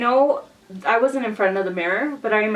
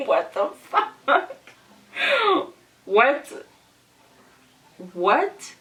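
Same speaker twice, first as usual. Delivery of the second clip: acting out stunned, halting questions; stunned disbelief; 5 semitones higher, much slower than usual. Retelling to viewers the hairdresser's water request, stunned. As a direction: let the shock land, rising on each what.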